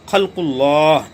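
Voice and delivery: खल्कुल्लाह recited with a thick, full l; heavy lam. Shown as a demonstration. Teacher reciting the example word in Quranic style. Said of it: "लाम को मोटा करके पुर पढ़ेंगे".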